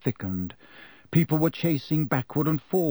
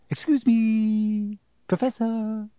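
high, drawn-out sing-song call; slow, tentative calling; cautious; 9 semitones higher, slower than usual. A narrator's reading. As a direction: coax a sleeper awake politely. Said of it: "approached Professor Lupin cautiously".

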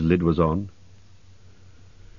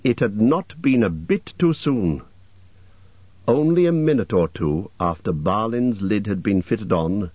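plain reading voice

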